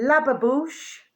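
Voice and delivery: Lababouche said slowly as la-ba-boosh with a rising end; drawn-out Lababouche, rising at the end; sounding out a word. Shown as a demonstration. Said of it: "I can actually flipping pronounce that".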